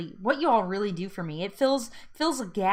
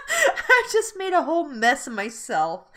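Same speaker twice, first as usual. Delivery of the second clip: real teary laugh, louder; laughing through tears; 8 semitones higher, 7 dB louder than usual. Real, not acted. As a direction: giggle while wiping tears. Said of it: "I can't even talk now".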